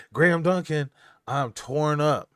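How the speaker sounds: mock lament to a viewer; mock heartbroken. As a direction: playfully overdo being torn up. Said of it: "It's breaking me up".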